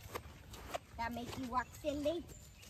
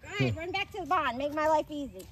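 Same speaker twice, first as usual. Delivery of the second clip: louder, fast, mock-annoyed at the runaway; loud exasperated call; 10 dB louder than usual. Real, not acted. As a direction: shout after the horse. Yelling at running horse.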